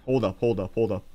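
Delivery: normal talking voice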